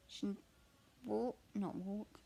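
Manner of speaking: ordinary delivery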